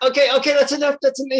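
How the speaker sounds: high, loud pleading to stop; acted panicked protest. Client begging laser technician to stop, acted panic. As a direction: cry out as if it hurts too much.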